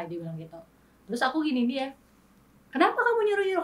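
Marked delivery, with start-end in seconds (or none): 2.7-3.6 sharp, high indignant snap; angry retort. Retold or imitated. Wife snapping back at her husband's advice, high and angry. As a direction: throw the question back, offended. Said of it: "aku bener-bener dengan nada ini".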